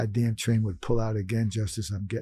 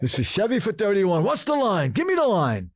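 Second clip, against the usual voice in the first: much higher, louder, pushy bark; exaggerated demand; 9 semitones higher, 3 dB louder than usual. Shown as a demonstration. Calling the bookie, mock pushy demand. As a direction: demand it like a desperate gambler. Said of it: "I wouldn't say it like that".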